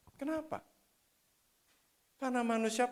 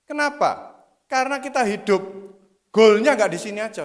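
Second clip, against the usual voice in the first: preaching at full force; 4 semitones higher, 13 dB louder than usual. Acted. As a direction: preach it hard, hammering each bukan. Khotbah penuh semangat. (khotbah berapi-api dengan tekanan keras)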